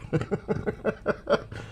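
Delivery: soft run of high chuckles. Real, not acted. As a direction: chuckle quietly at your own remark. Laughs at getting banned by chat.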